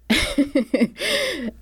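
high-pitched giggle. Real, not acted. About to tell the funny part, high giggle. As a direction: giggle lightly before going on.

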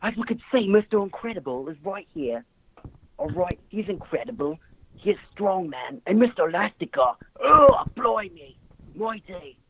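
halting, hammed-up British accent; put-on British accent. Caller doing requested movie review, put-on British accent. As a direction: attempt a silly British accent, drawing words out. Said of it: "describe the plot in a hilarious accent".

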